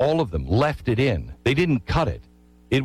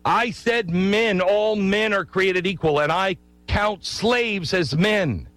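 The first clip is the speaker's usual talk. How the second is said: higher, slow, declaring Jefferson; defiant Jefferson; 7 semitones higher, slower than usual. Retold or imitated. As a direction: proclaim it slowly to the king, pitch raised. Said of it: "wants the king to see it and remember".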